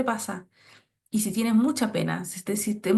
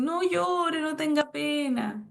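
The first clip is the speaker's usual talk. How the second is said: higher, slowing parent hush; soothing my son; 5 semitones higher, much slower than usual. Retold or imitated. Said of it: "entonces uno dice".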